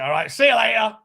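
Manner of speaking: mock casual farewell; breezy brush-off. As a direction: toss it off like a bloke already halfway out the door. Casual one-night-stand bloke voiced.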